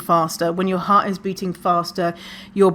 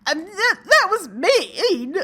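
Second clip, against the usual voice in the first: mock crying; 11 semitones higher than usual. Hurt by a mean remark, mock tearful and high. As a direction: squeak it out, close to tears. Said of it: "you just start crying".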